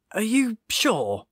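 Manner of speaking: high, slow, disbelieving question; incredulous. A narrator's reading. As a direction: sound utterly unconvinced. Badger doubts his name.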